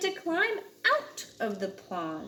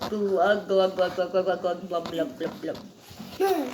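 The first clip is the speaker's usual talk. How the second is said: low, fading glug sounds; sinking glugs; 5 semitones lower than usual. Acted. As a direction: let it fade as it sinks. Big Blub sinking away.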